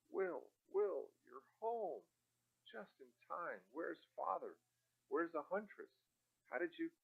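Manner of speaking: high, tearful rush of questions; tearful relief. A narrator's reading. Sister relieved to see brother home, tearful and high. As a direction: spill out relief and questions at once. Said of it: "couldn't restrain the tears".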